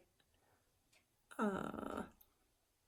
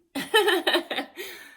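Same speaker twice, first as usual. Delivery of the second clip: short high laugh, louder; a wry burst of laughter at her own words; bitter laugh; 12 semitones higher, 6 dB louder than usual. Real, not acted. Laughing bitterly to viewers about her father's death, high and loud. Laughs after I was so happy.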